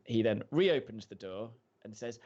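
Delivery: normal talking voice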